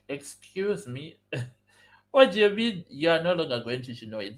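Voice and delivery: indignant disbelief. Retold or imitated. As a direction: react in retold shock at being let down. Indignant reply to broken promise.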